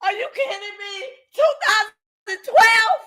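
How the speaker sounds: high, incredulous cry rising to a shout; outraged disbelief. Real, not acted. Outraged at the date just heard.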